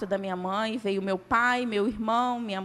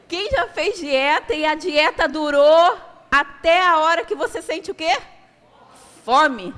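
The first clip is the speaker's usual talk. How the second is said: loud, higher call-and-response preaching; fiery preaching; 6 semitones higher, 8 dB louder than usual. Acted. Preacher asks the congregation about failed diets, fiery and loud. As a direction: rouse the congregation with each question.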